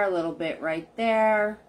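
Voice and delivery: normal talking voice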